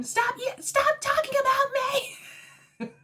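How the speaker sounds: very high mock shriek, drawn out; hammed-up screechy wail; retold screaming. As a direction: imitate a meltdown scream, pitch way up. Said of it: "She's screaming".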